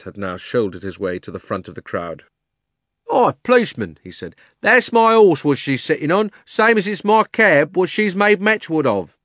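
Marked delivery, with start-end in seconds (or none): 3.1-4.0 high, loud hail; Cockney cabby. Acted. Cabby voiced. 4.6-9.2 high, loud, aggrieved claim; a cabby furious about his cab; indignant Cockney cabby. Acted. Cabby voiced.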